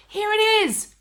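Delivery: high, loud triumphant call; excited cry. A narrator's reading. Mister Cranky rushing into the kitchen, triumphant cry. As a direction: burst in with the goods. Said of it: "cried Mister Killycranky".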